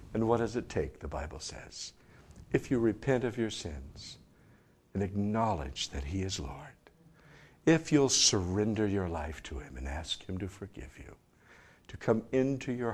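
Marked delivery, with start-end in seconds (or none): none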